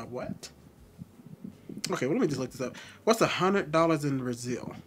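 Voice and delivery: ordinary delivery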